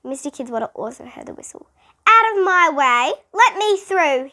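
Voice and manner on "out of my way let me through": loud, high commanding shout; barked orders. A narrator's reading. Principal forcing his way through the kids, loud orders. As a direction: push through the crowd with authority. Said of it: "he ordered".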